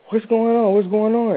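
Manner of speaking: high-pitched re-enacted alarm; retold alarmed question. Arriving among crying, screaming people, retold alarmed question. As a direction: urgently repeat it, pitched high.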